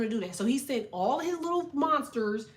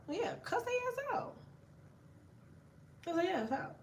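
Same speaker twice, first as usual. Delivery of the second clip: high, soft squeaky urging; high squeal; 9 semitones higher, 9 dB quieter than usual. Real, not acted. Streamer urging viewers to cuss out demons, high squeak.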